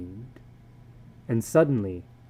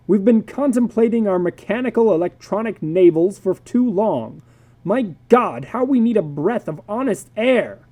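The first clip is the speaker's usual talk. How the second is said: angry father; 6 semitones higher, 5 dB louder than usual. Acted. Father's outburst.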